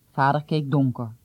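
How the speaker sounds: ordinary delivery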